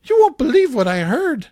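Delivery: high, loud gossip voice; gossiping balcony ladies. Retold or imitated. Host voices the balcony ladies gossiping, high and loud. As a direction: squawk it like a clucking gossip. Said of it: "They're saying things like".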